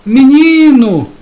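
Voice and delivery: put-on high, loud yell at the kid; father yelling. Imitating a father shouting at his son. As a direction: shout the boy's name across the house. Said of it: "E aí ele fala".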